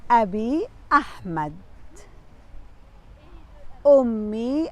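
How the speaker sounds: slow, emphatic reading with drawn-out syllables; teacher's model reading. Shown as a demonstration. Teacher rereading the family names to pupils, slow model reading. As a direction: read each short phrase out slowly for learners to follow. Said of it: "نعيد القراءة".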